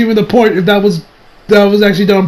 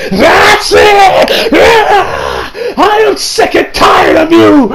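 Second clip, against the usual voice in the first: high, drawn-out enraged shout; Vegeta; 11 semitones higher, much slower than usual. Retold or imitated. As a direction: roar out in hammed-up rage. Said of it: "with that Saiyan rage".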